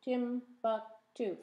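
Timbuktu said slowly, beat by beat; slow syllables. Shown as a demonstration. Teacher counting syllables with the class, slow. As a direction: draw out Timbuktu so each syllable stands apart. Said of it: "Three syllables".

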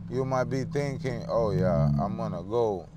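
ordinary delivery

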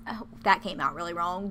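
ordinary talk

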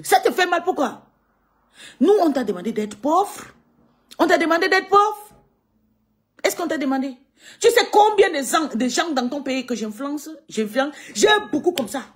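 high, loud furious challenge; angry rant. Real, not acted. Scolding the man who insulted her family, angry. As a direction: throw the questions back hard.

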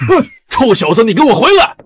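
huffy, high-pitched order; angry calling back. Acted. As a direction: shout after a deserter. Father orders departing son back.

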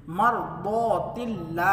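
मरदौतिल्ला very slow with a light thin ल्ला; slow demonstration of मरदौतिल्ला; thin lam. Shown as a demonstration. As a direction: recite मरदौतिल्ला showing the fine ल. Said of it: "जो हर्फ है उसके नीचे जेर है".

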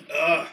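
loud grossed-out ugh; disgusted groan. Real, not acted. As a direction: groan at a foul aftertaste. Aftertaste of the rotten egg.

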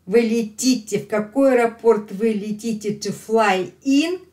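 ordinary talk, nothing marked

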